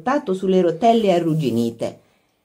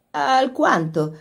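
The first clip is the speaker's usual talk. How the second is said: put-on slow, drawn-out boyish reply; warm boyish voice; 7 semitones higher, slower than usual. A narrator's reading. Lori answering his sister about parties, warm and boyish. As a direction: answer like an affectionate brother, slowly. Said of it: "disse la calda voce da ragazzo di Lori".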